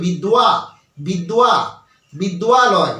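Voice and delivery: বিদ্যা then বিদ্যালয় built up with a wa glide; drilling the wa. Shown as a demonstration. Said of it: "এই বিদ্যালয় উচ্চারণ করে দেখো". (ধাপে ধাপে ওয়া দিয়ে বিদ্যালয়)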